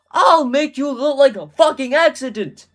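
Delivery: loud, high screamed threat; Cat in the Hat. A narrator's reading. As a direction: scream it as a cartoon villain threat. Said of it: "the cat screamed again".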